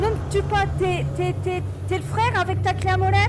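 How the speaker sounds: loud, high panicked plea; frantic in-character begging; Flynn pleading. Acted. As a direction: beg for your life, shouting. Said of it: "je joue Flynn, donc, euh, je dois la rassurer".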